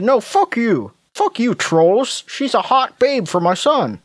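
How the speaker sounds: high, mocking sneer at the trolls; mock angry parents. Mocking the parents who brushed off the warnings, high sneer. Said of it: "And they said".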